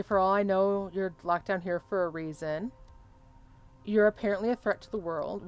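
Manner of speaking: normal talking voice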